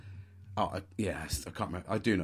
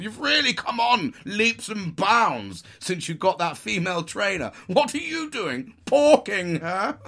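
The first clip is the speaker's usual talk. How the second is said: loud, high, drawn-out posh bellowing; hammed-up booming congratulations; hearty shouted praise; 11 semitones higher, 8 dB louder, slower than usual. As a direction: holler jovially across a tennis court. Acted tennis players shouting praise.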